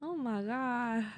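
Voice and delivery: ordinary talk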